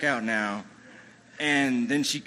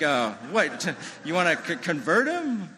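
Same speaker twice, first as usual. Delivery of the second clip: stammering, incredulous question climbing higher and louder; acted disbelief; 4 semitones higher, 3 dB louder than usual. Dad questions the rabbi about converting his son, incredulous. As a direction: balk at the idea, pitch rising into the question. Startled dad's objection, acted.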